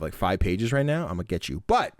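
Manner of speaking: ordinary talk, nothing marked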